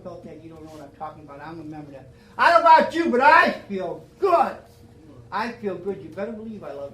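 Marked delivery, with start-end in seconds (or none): 2.4-4.6 joyful shout. Real, not acted. Speaker proclaiming to the meeting he feels good, jubilant shout. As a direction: shout it out with joy, drawing out the end.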